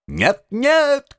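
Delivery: high-pitched cry of denial; shouting. A narrator's reading. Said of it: "кричал студент Ансельм". (кричит, протестуя)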